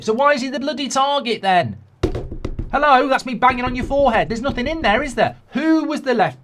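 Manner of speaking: high, loud mock rant at the club; exasperated berating. Fan mocking the club for an empty head, high and loud. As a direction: give them a furious telling-off.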